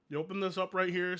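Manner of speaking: ordinary delivery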